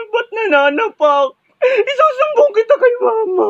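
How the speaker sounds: slapped student cries and threatens to tell mama; hammed-up crying. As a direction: blubber like a child tattling to mom. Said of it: "Iyakin din naman pala".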